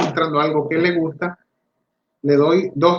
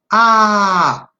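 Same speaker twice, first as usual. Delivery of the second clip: Ah called out loud and higher, touching the mouth; modeling shout; 5 semitones higher, 6 dB louder than usual. Shown as a demonstration. Therapist models the first sound to the child, loud. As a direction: shout a long clear Ah for the child to copy.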